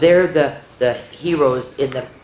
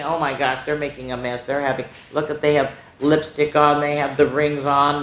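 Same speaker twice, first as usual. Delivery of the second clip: usual speaking voice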